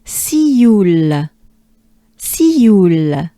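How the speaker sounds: "Sioule" stretched out in French; French Sioule, see-you gliding into a final L; French pronunciation demo. Shown as a demonstration. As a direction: model Sioule long and emphatic. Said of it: "But with a French accent".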